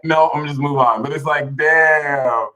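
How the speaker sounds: ordinary talk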